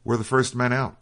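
understated cry from a hailing officer; officer's narrated cry. A narrator's reading. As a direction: read it as a restrained, high-pitched cry. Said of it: "cried the officer".

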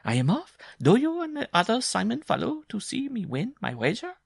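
loud, high-pitched bride voice; eager bride. A narrator's reading. Bride summoning Simon to follow, loud and high.

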